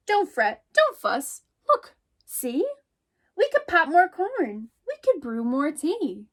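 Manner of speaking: a little mouse calling out, startled. Acted. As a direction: call out as if someone just knocked. Teacher reads picture book aloud.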